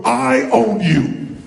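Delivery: slow, loud challenge; drawn-out claim thrown at a rival; challenging. Shown as a demonstration. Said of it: "point them and go".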